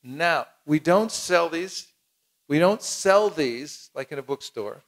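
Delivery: slow down and lean on don't and sell; calm, measured explanation; slow, deliberate. Shown as a demonstration. Distributor slowly explaining the book isn't sold. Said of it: "And say".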